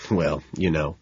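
soft, low, bashful murmur; shy. Acted. Man thanked for his poem, looking down shyly. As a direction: shrug off praise shyly. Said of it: "I say, looking down shyly".

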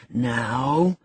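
low, restrained menace; curbed ferocity. A narrator's reading. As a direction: hold the anger in. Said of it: "said he with curbed ferocity".

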